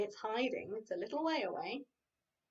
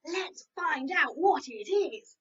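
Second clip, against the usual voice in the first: slow, louder eager call; excited; 3 semitones higher, 7 dB louder, slower than usual. Acted. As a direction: urge the ducks on eagerly. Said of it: "Posy said excitedly".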